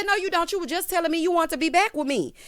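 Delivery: high, quick contradiction; argumentative retort. Retold or imitated. Wife contradicting husband about wanting her back, retold high and insistent.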